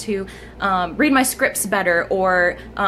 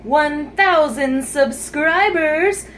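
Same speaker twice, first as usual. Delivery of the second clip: slow, higher, drawn-out proclamation; proudly drawn out, pitched up; milestone announcement; 6 semitones higher, much slower than usual. Real, not acted. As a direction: stretch every word out as a big announcement.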